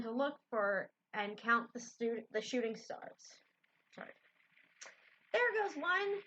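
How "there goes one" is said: excited higher-pitched exclamation; exclaiming. A narrator's reading. Amy spotting a shooting star, exclaiming. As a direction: call it out, lifted in pitch. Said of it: "exclaimed Amy".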